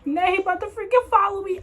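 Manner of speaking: high panicked exclaim; rising alarm, he's coming in; telling herself he'll follow her, in a high panic. Retold or imitated. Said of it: "I was so scared".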